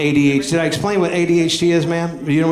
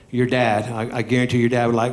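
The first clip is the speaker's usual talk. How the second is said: usual speaking voice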